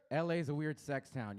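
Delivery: normal talking voice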